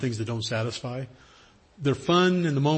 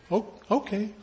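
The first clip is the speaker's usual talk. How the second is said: put-on high, small voice of a let-down kid; a child hiding his letdown; disappointed little boy; 8 semitones higher, 4 dB quieter than usual. Boy answers softly as the speaker leaves.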